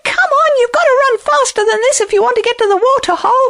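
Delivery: very high, fast hare voice; high-pitched, hurried little animal; urging hare. Acted. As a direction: chivvy a straggler in a rush. Hare urging on the lagging lion.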